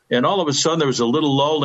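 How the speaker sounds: normal talking voice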